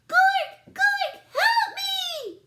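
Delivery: very high, loud panicked shout; crying for help. A narrator's reading. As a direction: scream for her brother. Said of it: "she cried".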